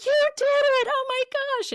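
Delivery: very high, loud squeal of joy; retold delighted squeal. Mom celebrates daughter solving the word, retold delighted squeal. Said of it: "we were squealing together".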